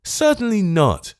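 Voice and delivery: high, slow flat refusal; emphatic. Acted. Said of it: "most emphatically".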